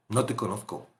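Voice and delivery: low quiet rejection. Acted. Jesus repeating rejection.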